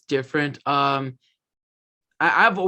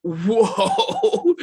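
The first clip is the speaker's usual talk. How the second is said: surprised whoa; 7 semitones higher, 5 dB louder than usual. Retold or imitated. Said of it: "I'm like".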